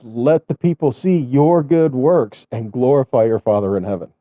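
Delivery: normal talking voice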